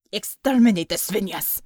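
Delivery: a touch of a harsh barked command; harsh order. A narrator's reading. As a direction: order the kill coldly. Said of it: "she ordered harshly".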